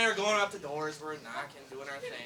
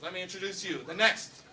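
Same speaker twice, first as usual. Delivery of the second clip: announcer introduction; 4 semitones higher, 17 dB louder than usual. Acted. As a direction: belt the name out like a boxing announcer. Introducing the next mayor.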